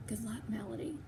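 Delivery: soft unvoiced whisper. A narrator's reading. Catherine whispering goodbye to Melody as she leaves. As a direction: breathe it out as a goodbye. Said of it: "she whispers as she leaves".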